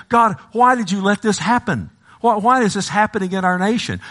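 high, urgent cry to God; pleading complaint. Retold or imitated. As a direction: demand an answer from heaven. Acting believers' complaint to God.

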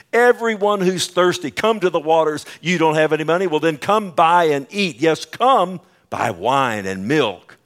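loud, high proclamation; full-force preaching. Acted. Preacher invites the thirsty to come, proclaiming loudly.

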